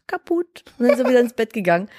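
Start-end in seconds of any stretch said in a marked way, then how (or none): 0.1-0.6 put-on high, soft child voice; tiny soft high voice; imitating toddler son. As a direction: mimic a small child quietly insisting. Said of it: "meint dann so zu mir".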